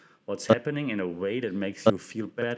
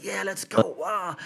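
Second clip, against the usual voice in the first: mock-excited, higher cheer; excited young player; 8 semitones higher, slower than usual. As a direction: imitate a hyped-up kid cheering. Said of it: "He's very excited".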